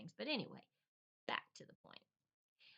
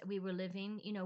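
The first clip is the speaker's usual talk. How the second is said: normal talking voice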